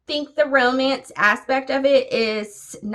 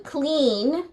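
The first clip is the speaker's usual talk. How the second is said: CLEAN set apart and pitched higher; stress on CLEAN; 6 semitones higher than usual. Real, not acted. Reader picking a cute clean Christmas romance, CLEAN emphasised. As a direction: lean on the word CLEAN, lifting it high. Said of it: "emphasis is on the clean".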